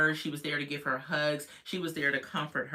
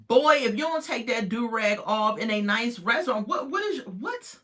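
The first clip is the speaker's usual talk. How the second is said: exasperated scold; 6 semitones higher, 4 dB louder than usual. Real, not acted. Scolding the man in the do-rag.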